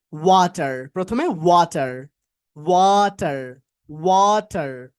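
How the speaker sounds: "ওয়াটার" slowly, long WAA and a clear t; loud, stretched "ওয়াটার", WAA-tar with hard t; full-T pronunciation first. Shown as a demonstration. Teacher starts the class off with slow clear-t "ওয়াটার". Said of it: "হঠাৎ করে উচ্চারণ করতে পারবেন না".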